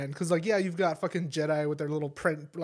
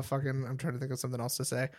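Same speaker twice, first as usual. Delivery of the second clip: ordinary talk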